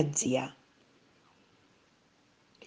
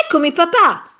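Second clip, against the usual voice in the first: high, louder cheerful call; eager exclamation; 10 semitones higher, 5 dB louder than usual. A narrator's reading. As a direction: announce yourself brightly to dad. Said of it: "esclamò Tonino". (esclamazione acuta e allegra)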